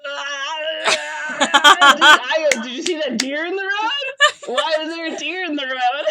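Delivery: high, loud hammed-up shouting; mock panicked yell. [name] swerves for a deer, hammed-up yelling. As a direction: act out swerving in alarm, laughter creeping in.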